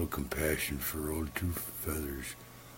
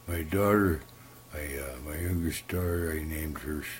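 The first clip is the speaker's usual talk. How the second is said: ordinary delivery, nothing marked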